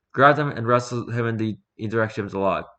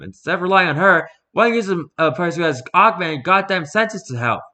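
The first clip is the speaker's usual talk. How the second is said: loud, higher, pushing over the crowd; shouting; 8 semitones higher, 6 dB louder than usual. A narrator's reading. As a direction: keep yelling the challenge across the camp. Shouting across camp at Hannigan.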